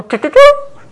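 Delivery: very high, loud mock stammer; mock squeal. Mocking a naive believer's prayer, high squeak.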